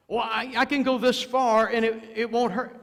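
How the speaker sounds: high, loud careless boast; mock self-assurance. Preacher mocking the one who says it won't hurt, loud and high. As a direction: mimic a sinner bargaining with himself.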